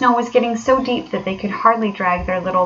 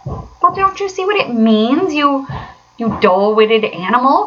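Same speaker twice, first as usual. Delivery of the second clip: high, exasperated outburst; impatient cry; 5 semitones higher than usual. A narrator's reading. Rat impatient with the dull Mole, exasperated. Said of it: "cried the Rat impatiently".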